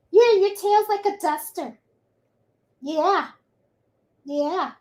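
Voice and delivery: high-pitched coo to a dog; doting pet talk. Real, not acted. Telling the dog about its tail, doting pet talk. Baby-talking to her dog.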